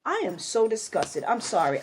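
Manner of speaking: ordinary delivery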